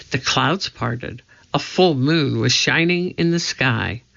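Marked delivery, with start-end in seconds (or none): none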